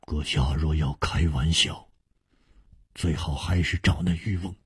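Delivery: low, soft, icy rebuff; cold. A narrator's reading. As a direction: brush them off coldly in a hushed low voice. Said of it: "却只是冷冷地说". (冷冷地低声回绝)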